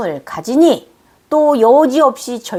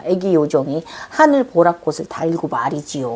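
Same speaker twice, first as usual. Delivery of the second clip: ordinary talk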